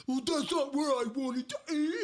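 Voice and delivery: high, whiny mimicry of a spouse; mocking spouse voice. Mimicking a spouse in a fight, whiny high voice. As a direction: whine it in a squeaky mock voice. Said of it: "their voice, whether it's male or female, turns into".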